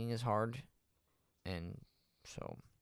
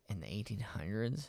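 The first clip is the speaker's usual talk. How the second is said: whispered; 4 dB quieter than usual. Real, not acted. Musing over a boxing name.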